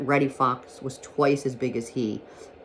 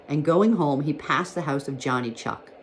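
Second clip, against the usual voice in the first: ordinary delivery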